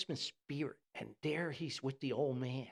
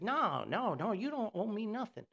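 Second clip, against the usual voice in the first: higher, lightly louder big-guy voice; jolly giant waving it off; 8 semitones higher, 3 dB louder than usual. Retold or imitated. Tree guy refusing the old man's money, lightly voiced and higher. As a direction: brush off the offer, cheerfully.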